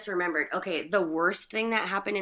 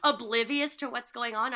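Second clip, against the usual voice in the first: ordinary talk, nothing marked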